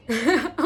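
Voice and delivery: brief chuckle; little amused laugh; short laugh. Real, not acted. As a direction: laugh briefly at your own remark. Laughs at her own maths remark.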